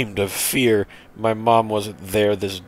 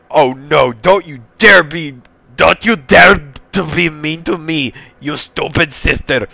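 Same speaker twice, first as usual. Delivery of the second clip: raised, higher indignant voice; angry retort; 6 semitones higher, 6 dB louder than usual. Acted. Brother warning his stupid sister, angry and higher. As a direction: snap back loudly at your sister.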